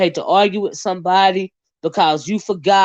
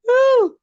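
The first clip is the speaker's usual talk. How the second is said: woo. Real, not acted. Shaking off nerves.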